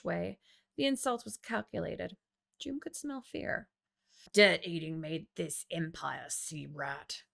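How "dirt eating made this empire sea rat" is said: low growl fading out; a hint of a bitter growl; growled retort. A narrator's reading. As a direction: growl the insult back, lower. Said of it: "Joom growled".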